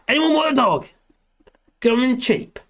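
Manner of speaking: high, sing-out offer with falling ends; mock sales call. Streamer offering the restless dog to viewers, mock sales call. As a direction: jokingly hawk it to whoever's listening. Jokingly hawking his dog.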